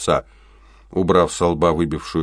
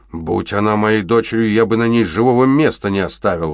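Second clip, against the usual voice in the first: louder, angry outburst; взрыв гнева; 5 semitones higher, 6 dB louder than usual. A narrator's reading. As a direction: burst out in anger. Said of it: "взорвался Биглер". (гневно, громче обычного)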